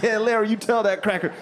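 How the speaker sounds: high-pitched laughing cheer; giddy high hype-man cheer; high egging-on cheer. Retold or imitated. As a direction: egg your buddy on, giggling.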